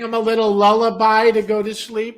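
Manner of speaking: teasing. Acted. Teasing about lullaby for trees.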